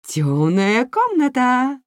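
high, loud, slow ringing announcement. Acted. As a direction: proclaim it like a grand showstopper. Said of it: "объявила она звонко".

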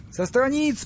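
high, declaimed phrase; oratorical repeat. A narrator's reading. Stas picks up his faltering speech, high and oratorical. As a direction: proclaim it high, as from a rostrum. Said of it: "повторил Стас".

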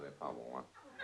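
muted reaction. Acted. Doctor's hushed reaction in joke.

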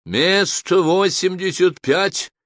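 loud, high, drawn-out machine announcement; ringing, slow automated call; booming computer. A narrator's reading. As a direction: thunder it out slowly like a computer calling numbers. Same computer calls next place.

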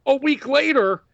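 high-pitched mock announcement voice; Shane McMahon. Host acting out next week's ruling, high put-on voice. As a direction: put on a high official's voice, easing down. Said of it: "Shane McMahon goes".

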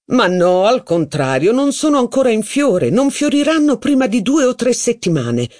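lightly voiced pedantic archivist; knowing archivist. A narrator's reading. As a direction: set them straight with authority. The archivist contradicts.

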